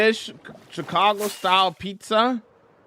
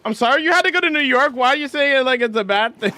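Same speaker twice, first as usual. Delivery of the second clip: mock outrage; 9 semitones higher, 7 dB louder than usual. Real, not acted. Mock offense at chat's question.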